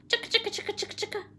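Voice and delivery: high, rattling squirrel noise; squirrel chatter. Acted.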